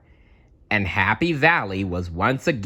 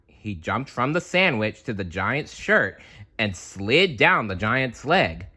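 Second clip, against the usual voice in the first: plain reading voice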